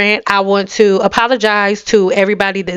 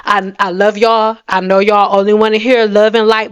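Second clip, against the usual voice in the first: ordinary talk, nothing marked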